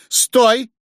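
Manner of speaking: high, loud alarmed stop; urgent call. A narrator's reading. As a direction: cry out to halt him. Frightened wife halts her husband. (испуганный окрик)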